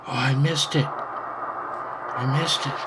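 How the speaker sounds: ordinary delivery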